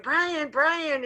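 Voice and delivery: high, loud call down the hallway; calling out his name. Retold or imitated. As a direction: shout after him to stop. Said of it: "And I'm screaming".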